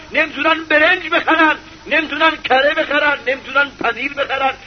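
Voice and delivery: ordinary talk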